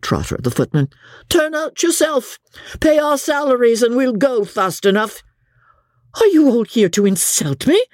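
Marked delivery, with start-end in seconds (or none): 1.3-5.2 high-pitched insolent retort; cheeky servant's comeback; defiant footman. A narrator's reading. Footman defies his mistress. 6.2-7.8 higher-pitched indignant cry; in a fury. A narrator's reading. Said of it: "cried Becky in a fury".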